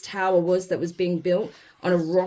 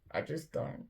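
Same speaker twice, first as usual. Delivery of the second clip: whispered; 3 semitones lower, 13 dB quieter than usual. Real, not acted. At a loss, trails off.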